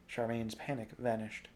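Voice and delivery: usual speaking voice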